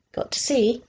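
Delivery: ordinary delivery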